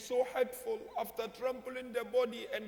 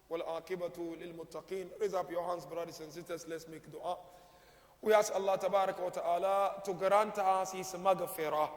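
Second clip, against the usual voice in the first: lower, softer prayer voice, Arabic phrases fast; closing dua; 4 semitones lower, 4 dB quieter than usual. Acted. Preacher leading mourners in supplication, hushed and low. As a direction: pray gently over the congregation.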